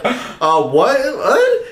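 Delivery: retold flustered stammer, pitch leaping up. Caught saying it in class, flustered. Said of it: "I'm just all flustered".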